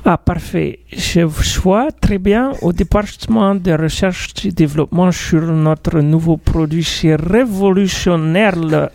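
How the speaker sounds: lightly put-on Icelandic accent; a hint of a foreign Icelandic accent. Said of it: "mon accent islandais".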